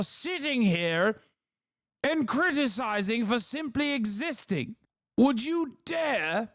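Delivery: high, slow, hammed-up British oratory; mock British accent. Acted British rebuttal to anti-migrant argument.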